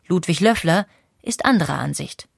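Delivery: usual speaking voice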